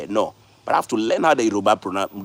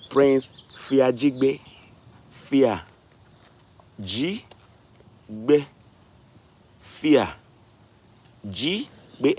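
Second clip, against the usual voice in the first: very slow, name broken at each syllable; teaching syllable by syllable; much slower than usual. Shown as a demonstration. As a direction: spell it out by sound with long pauses. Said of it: "use opportunity to teach them".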